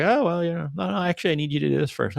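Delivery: high, light casual voice; easygoing reply. Retold or imitated. Boss tells the shop guys what comes first, high and breezy. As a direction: keep it breezy and unbothered. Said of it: "And they're like".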